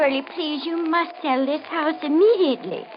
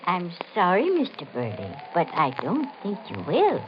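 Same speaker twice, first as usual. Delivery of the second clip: ominous prophecy; 7 semitones lower than usual. Acted. Woman warns buyer he will never live here, low and foreboding.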